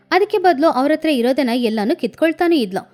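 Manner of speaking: ordinary delivery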